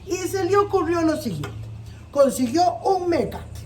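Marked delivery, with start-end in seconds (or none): none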